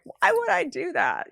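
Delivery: high, disbelieving question; mock an unconvinced beginner; incredulous. Imitating a doubtful newcomer.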